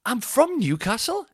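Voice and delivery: high, slow, pointed retort; drawn-out incredulous answer; indignant reply. Retold or imitated. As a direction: spell it out to the bigot. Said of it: "And I just went".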